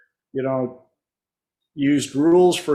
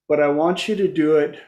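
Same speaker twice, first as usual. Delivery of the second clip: ordinary delivery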